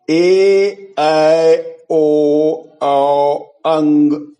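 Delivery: each vowel held long and loud; long vowel drill. Shown as a demonstration. Teacher demonstrating long vowels to students, loud and held. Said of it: "ये सभी दीर्घ हैं".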